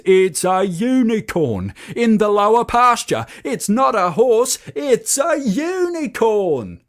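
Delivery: high, loud panicked yell; yelling at top of voice. A narrator's reading. Bull running into the barn, yelling at the herd. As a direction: shout it as loud as you can. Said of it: "yelling at the top of his voice".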